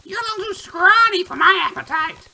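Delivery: put-on very high, hungry old witch. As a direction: size up the prey like a witch. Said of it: "then states".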